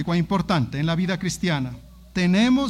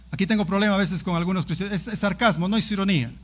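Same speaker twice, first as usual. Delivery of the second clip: usual speaking voice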